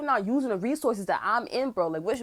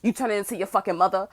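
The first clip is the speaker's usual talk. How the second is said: ordinary delivery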